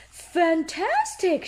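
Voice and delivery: high, slow, drawn-out fantastic; delighted. Acted. Peppa admiring heart sunglasses, high slow delight. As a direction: savour the word.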